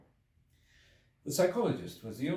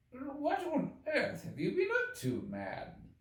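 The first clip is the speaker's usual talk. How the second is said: much higher, slow, astonished doctor; slower than usual. A narrator's reading. Doctor questioning the dishevelled time traveller, astonished. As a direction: press him, bewildered.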